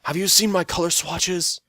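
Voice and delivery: loud, high call down the hall; fussy shout from afar. Acted. The Demon King calling to Phil about his swatches, loud.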